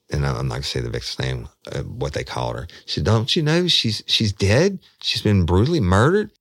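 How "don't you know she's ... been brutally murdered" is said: much higher, louder, alarmed; freaked out. Retold or imitated. Woman telling him the victim was murdered, alarmed high voice. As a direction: push the news out in shock. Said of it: "it freaked me the fuck out".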